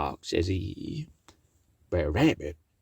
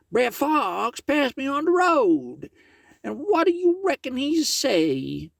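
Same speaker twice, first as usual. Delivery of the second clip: pitched well up for a critter in the tale; high character voice; 3 dB louder than usual. Acted. Brer Tarrypin telling Miss Meadows the news, high character voice.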